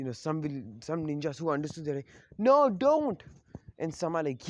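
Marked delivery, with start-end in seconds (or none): 2.4-3.2 high, loud panicked shout; alarmed warning. Acted. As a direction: cry out to stop them.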